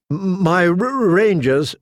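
stammering, nervous officer; stuttering. A narrator's reading. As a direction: trip over the first sounds. Smallwood stutters over words.

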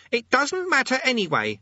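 put-on high-pitched boyish shout. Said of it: "he shouted".

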